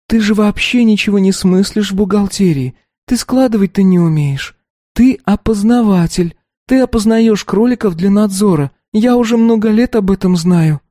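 put-on high, light girlish voice; young girl voice. A narrator's reading. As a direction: voice the earnest teenage daughter. Narrator voices the daughter.

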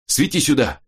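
high, urgent command; urgent order. A narrator's reading. Robin has found something under the stone, urgent order. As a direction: demand the light right away.

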